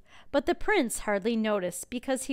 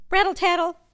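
playful rattling noise; rattling sound effect; 8 semitones higher, 11 dB louder, faster than usual. Acted. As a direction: say it like rattling glass. Storm rattles windows.

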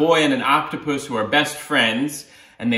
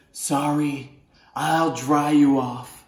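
mild octopus voice; much slower than usual. Acted. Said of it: "the octopus says".

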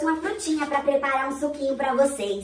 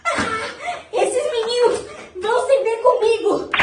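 outraged shriek; 10 semitones higher than usual. Acted. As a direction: scream at the broken vase. Grandma finds broken vase. (grito indignado de avó)

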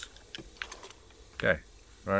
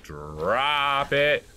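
long, slow, rising draw on the words; drawn-out emphasis; 4 semitones higher, 5 dB louder than usual. Real, not acted. Player planning the elevator drop, drawn-out emphasis. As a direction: stretch the words right out.

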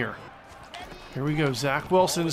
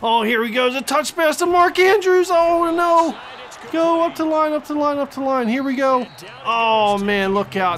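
loud, high urgent shouting; frantic play-by-play; 7 semitones higher, 5 dB louder than usual. Real, not acted. Fan urges team to the line, frantic. Frantic call, clock running out.

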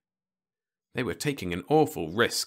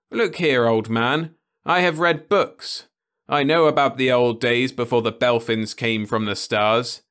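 higher, louder firm lecture; exasperated young man; 5 semitones higher, 7 dB louder than usual. Acted. Ludovic lectures Osmond on history, firm and raised. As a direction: set the old man straight.

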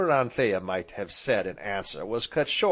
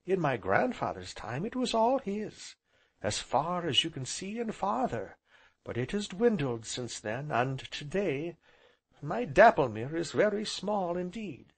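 lightly voiced heroine, higher pitch; wistful heroine; 6 semitones higher than usual. A narrator's reading. Heroine tells guest how the property dwindled, lightly wistful. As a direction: tell it in a lightly raised voice.

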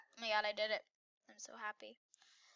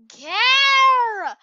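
defiant shout; 11 semitones higher, 19 dB louder than usual. Real, not acted. Defiant exclaim.

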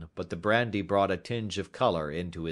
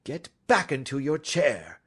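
firm, mildly stern command; 7 semitones higher, 5 dB louder than usual. A narrator's reading. Detective orders the begging culprit back, mildly stern. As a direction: order him with cool authority. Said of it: "said Holmes sternly".

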